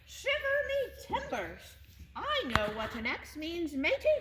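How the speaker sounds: high, bright pirate bird squawking at the tree; pirate crow. Acted. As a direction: play the old sea-dog crow, full of bluster. Captain Crow speaks as pirate.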